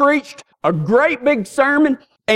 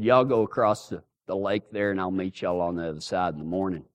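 lower, much softer, fast drawled Jesus; folksy Jesus; 10 dB quieter than usual. Retold or imitated. Jesus telling his disciples to cross the lake, low and soft. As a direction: voice Jesus as a soft-spoken Texan.

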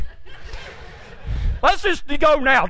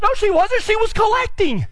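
high-pitched contradiction; 9 semitones higher than usual. Retold or imitated. Instructor contradicts, retold argument.

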